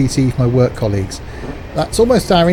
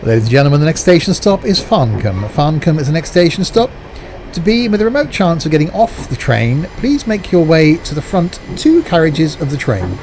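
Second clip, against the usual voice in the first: mock train announcer; 3 semitones higher than usual. Commuter mimicking conductor's PA announcements to friends. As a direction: hold a conductor's PA voice.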